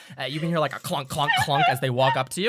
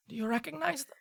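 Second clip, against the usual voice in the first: curious doctor; 12 semitones higher, 6 dB quieter, faster than usual. Acted. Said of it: "kinda say".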